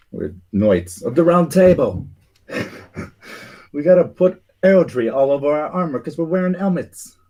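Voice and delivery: high, loud, put-on British knight voice; weak British accent. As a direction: ham up a posh British knight, high-pitched. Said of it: "I used my weak British voice".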